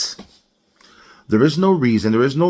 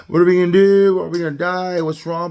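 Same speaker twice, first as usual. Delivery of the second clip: freaking out; 8 semitones higher, 3 dB louder than usual. Acted. Driver sees check engine light come on, freaking out. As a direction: wail it in an exaggerated panic. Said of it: "you start freaking out".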